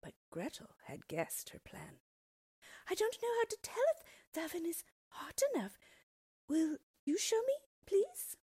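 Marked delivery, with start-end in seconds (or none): 2.9-8.4 put-on very high, loud innocent child; child asking. A narrator's reading. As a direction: play dumb like a little girl. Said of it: "they asked".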